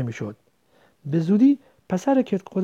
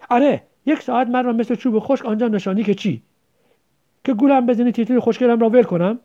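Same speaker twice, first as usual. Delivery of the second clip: higher, louder indignant complaint; aggrieved quarrel; 7 semitones higher, 6 dB louder than usual. Acted. Child accusing the other of trickery, aggrieved. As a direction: hurl an accusing question. Quarrel over drawing and lost pet.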